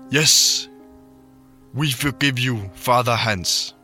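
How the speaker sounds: put-on Welsh and Irish accents. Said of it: "jeg lavede bare en walisisk og irsk accent".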